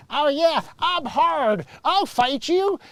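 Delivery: high, cocky mock tough talk; mock tough guy. Wannabe tough guys boasting, cocky mock voice. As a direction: puff yourself up like a wannabe hard man. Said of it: "Everybody's like".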